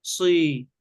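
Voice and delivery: "صي" as a clean "si", lips unrounded; teacher's careful "صي", no u colouring; correct kasra. Shown as a demonstration. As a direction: hold a pure i after heavy s in "صي". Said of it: "إنما نقول صي".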